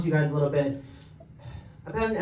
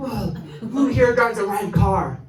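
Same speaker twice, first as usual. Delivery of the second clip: woman on a mission; 5 semitones higher, 3 dB louder than usual. Retold or imitated. Woman hunting a car's owner in a cafe, mock accusing demand. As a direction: demand it like someone hunting down a culprit. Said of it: "like, on a mission".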